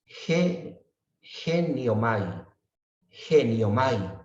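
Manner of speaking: "geniomai" said slowly, every syllable drawn out; teacher letting the class hear "geniomai", slow and clear; Greek word said slowly. Shown as a demonstration. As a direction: let them hear the sound of "geniomai", slow and deliberate. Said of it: "escuchen y el sonido les va a decir".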